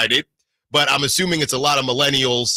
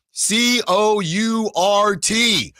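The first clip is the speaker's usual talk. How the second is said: spelled out slowly; 8 semitones higher, much slower than usual. Acted.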